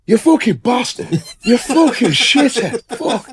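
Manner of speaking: high-pitched furious ranting, slowed; hammed-up angry yell; mock Irish rage. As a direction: rant like a raging drunk Irishman. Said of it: "he was like".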